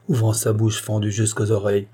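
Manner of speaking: ordinary delivery, nothing marked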